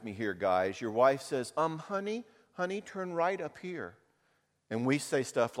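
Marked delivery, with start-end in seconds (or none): 1.6-3.9 wife's gentle direction. Retold or imitated. The wife giving directions.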